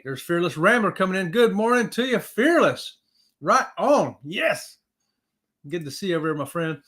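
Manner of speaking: ordinary delivery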